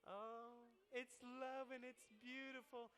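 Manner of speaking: slow, dreamy mock swoon; mock dreamy romantic. Preacher mocking romantic movies, slow and dreamy. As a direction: sigh it like a lovestruck movie fan.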